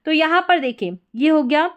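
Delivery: ordinary talk, nothing marked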